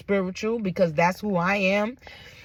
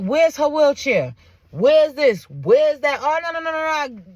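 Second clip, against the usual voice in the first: loud, insistent demands, pitch climbing; imitating a father who means business; not playing; 4 semitones higher, 5 dB louder, slower than usual. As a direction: fire off protective demands, stretching the words out. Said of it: "he like, he not playing".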